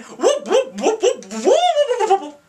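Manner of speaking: high, swooping cartoon whoops; mock slapstick whooping. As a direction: whoop like a silly slapstick sound effect. Mimics slapstick noise before pie gags.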